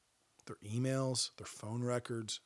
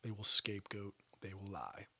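normal talking voice

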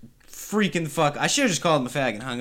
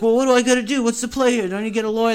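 much higher, pleading nervous voice; mock high panic; 6 semitones higher, faster than usual. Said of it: "I was like".

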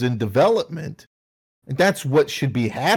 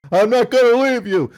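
high, loud refusal to leave; hammed-up war-movie shout, higher and louder; mock heroic shout; 8 semitones higher, 4 dB louder than usual. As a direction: yell it like a hammed-up movie buddy. Acted war-movie sacrifice quarrel.

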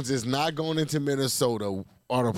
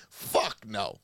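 ordinary talk, nothing marked

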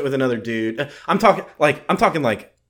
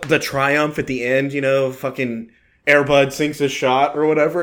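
ordinary talk, nothing marked

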